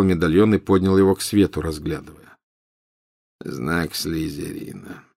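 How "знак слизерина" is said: soft, slow and low; quiet. A narrator's reading. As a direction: breathe it out, entranced. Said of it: "тихо сказал он".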